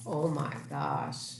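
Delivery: ordinary delivery, nothing marked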